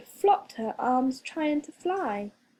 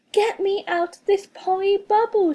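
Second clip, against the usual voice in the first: higher, louder shout to be let out; 8 semitones higher, 5 dB louder than usual. A narrator's reading. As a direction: yell it through the bubble. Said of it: "she shouted".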